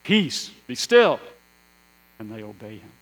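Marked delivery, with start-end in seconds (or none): 0.0-1.2 loud, high, slow command; Jesus. Acted. Standing in a boat commanding wind and waves, loud command. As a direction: call it out over a storm. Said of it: "saying to the wind and the waves".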